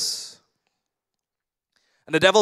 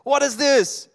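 higher, exasperated protest; frustrated complaint to God; 6 semitones higher than usual. Retold or imitated. A believer complains to God about driving a Grab car, higher and exasperated. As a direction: plead up high, baffled and fed up. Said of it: "we will say".

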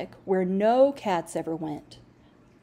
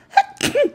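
cough; 9 dB louder than usual. Acted. Jasper sick, sniffling.

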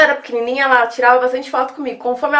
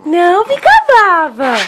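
soothing pet talk; 4 semitones higher, 5 dB louder, slower than usual. Real, not acted. Soothing the upset dog.

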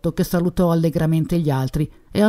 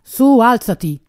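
sharp, impatient, a touch higher and louder; impatient command; 6 semitones higher, 5 dB louder, slower than usual. A narrator's reading. Commissioner ordering the exhausted suspect to stand, impatient. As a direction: snap him to his feet, out of patience. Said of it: "disse il commissario con impazienza".

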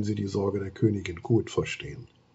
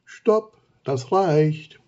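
much higher, loud cry of stop; calling stop; 6 semitones higher, 5 dB louder, much slower than usual. Acted. Queen Thea calls the sand-throwing wolves to stop. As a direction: halt them with a sharp call. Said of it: "bis Thea ruft".

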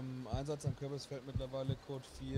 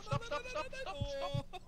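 high, urgent calling out to halt; panicked high calls of stop; 12 semitones higher, much slower than usual. Real, not acted. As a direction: yell stop at the driver, pitched way up. Warning a driver to stop.

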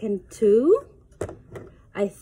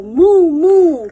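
louder playful moo; cow moo; 4 semitones higher, 9 dB louder than usual. Pretend. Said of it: "He can sound like a cow".

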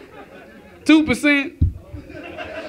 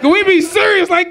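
ordinary talk

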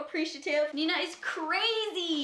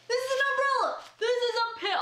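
excited squeal; 10 semitones higher, 3 dB louder, faster than usual. Real, not acted. Discovering umbrella gift.

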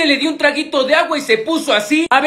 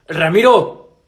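low call; 7 semitones lower, slower than usual. Acted. Teacher calls a sleeping pupil.

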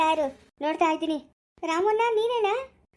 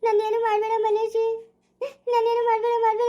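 high, wailing plea; repeated, whimpering protest; frightened pleading; 6 semitones higher than usual. Acted. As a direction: beg tearfully to be left alone. Frightened bride begs to be left alone.

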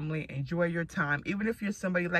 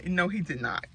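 normal talking voice

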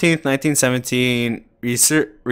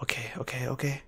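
tense whisper; 16 dB quieter, faster than usual. Real, not acted. Hiding, whisper.

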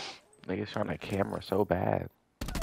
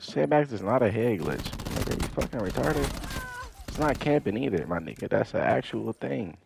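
ordinary delivery

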